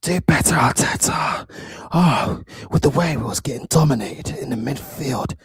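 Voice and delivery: low, put-on breathy voice; low, airy mock lament; mocking pundit imitation. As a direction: mimic an overdramatic complaint with no bass.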